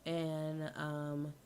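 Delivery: soft, low-volume murmur; very quiet. Real, not acted. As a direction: talk softly as if far from the mic.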